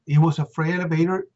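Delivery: normal talking voice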